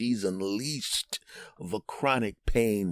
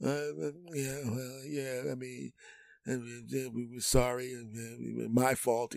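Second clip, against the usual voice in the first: very soft, higher mock-sheepish mumble; meek backing down; 4 semitones higher, 10 dB quieter, slower than usual. Husband apologising to wife by phone, meekly hushed. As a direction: shrink into a tiny apologetic voice. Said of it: "Captain Tough Guy goes from".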